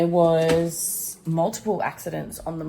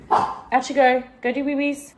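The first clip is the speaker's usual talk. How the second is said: high, louder sing-song to the dog; 9 semitones higher, 6 dB louder than usual. Real, not acted. Owner sends puppy out to pee, high baby talk. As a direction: shoo the pup out sweetly.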